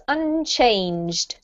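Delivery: "unchanged" slowly, -ed as a plain d, one syllable "changed"; no extra syllable. Shown as a demonstration. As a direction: end "unchanged" on a bare d with no "-ed" sound. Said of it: "try not to make the E D into an extra syllable".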